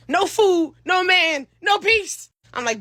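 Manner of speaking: ordinary delivery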